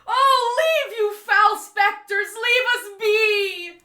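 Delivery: crying out, slow and pleading; a trembling guard wailing at phantoms; fearful pleading. Acted. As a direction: beg the specters to go away. Gatekeeper pleads with the ghosts.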